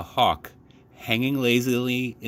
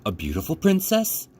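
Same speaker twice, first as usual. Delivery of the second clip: put-on eager child; 8 semitones higher than usual. A narrator's reading.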